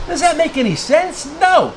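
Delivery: high, rising disbelief then a sharp no; indignant rhetorical. Real, not acted. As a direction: answer your own question in outrage. Rhetorical question and answer.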